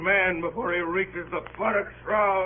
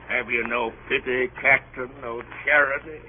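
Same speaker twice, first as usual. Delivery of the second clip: ordinary delivery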